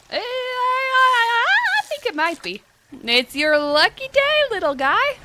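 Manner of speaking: high, drawn-out, loud silly voice; silly put-on voice. Player telling the little guy it's his lucky day, silly put-on voice. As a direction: pitch it way up and stretch the words.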